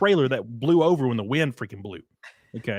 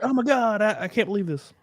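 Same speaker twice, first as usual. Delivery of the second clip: mock amazed girl; 7 semitones higher than usual. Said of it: "They're just like".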